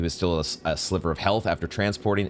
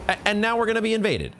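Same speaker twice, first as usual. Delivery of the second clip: exasperated outburst; 12 semitones higher, faster than usual. Real, not acted. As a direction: break into a frustrated cry at the game. Invasion after bugged fight.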